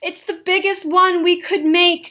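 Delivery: shouting. A narrator's reading. Friends fanning the glue dry, loud proud shout. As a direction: yell it proudly over the fan noise. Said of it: "they shout".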